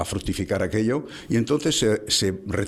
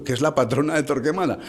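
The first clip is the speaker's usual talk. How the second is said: normal talking voice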